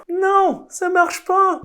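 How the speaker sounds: very high, loud comic yell; hammed-up screamed objection; exasperated shouting. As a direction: explode in mock outrage. Acted outburst rejecting absurd idea.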